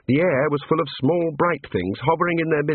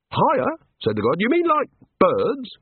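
high, bright small-god voice; cheerful little god; 10 semitones higher than usual. A narrator's reading. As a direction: play an eager little craftsman god. God character voice.

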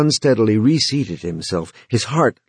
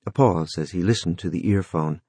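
usual speaking voice

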